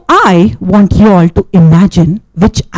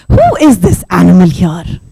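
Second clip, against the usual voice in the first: plain reading voice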